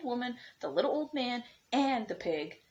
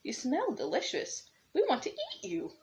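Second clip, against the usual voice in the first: put-on high, eager old woman voice; hungry old woman, rising high; the old woman begging him back, high; 9 semitones higher than usual. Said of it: "cried the little old woman".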